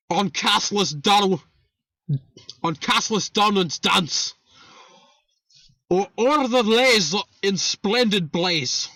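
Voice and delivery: theatrical mock-Irish poem recital; measured, hammed-up Irish brogue; put-on Irish accent. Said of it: "I will put on my Irish accent".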